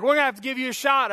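hammed-up threat from a rough nurse; retelling a scary nurse, mock gruff. Mean nurses threaten a shot.

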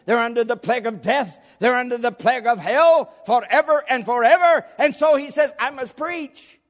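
high, loud, driving pulpit delivery; full-force preaching. Real, not acted. Preacher warning of a lost world, fervent and urgent. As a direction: build in urgent repeated waves to a high cry.

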